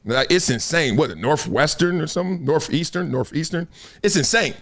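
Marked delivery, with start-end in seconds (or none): none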